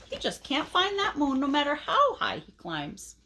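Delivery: ordinary delivery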